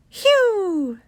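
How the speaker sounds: high falling whistled "whew"; mystery bird call. Acted. Narrator imitating the strange bird call.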